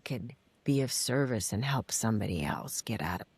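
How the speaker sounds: soft breathy whisper, fading out. Real, not acted. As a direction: drop to a hushed whisper. Drops to a whisper.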